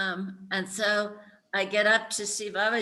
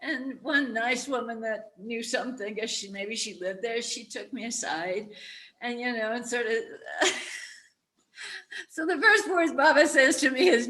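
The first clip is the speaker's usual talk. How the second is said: higher, emotional, half-laughing; teary; 6 semitones higher than usual. Real, not acted. Recalling her first meeting with the guru, teary. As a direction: hold back tears while telling it.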